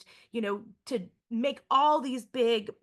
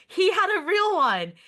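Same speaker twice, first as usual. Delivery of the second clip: excited; 8 semitones higher, 7 dB louder than usual. Real, not acted. Excited teacher stressing a fact.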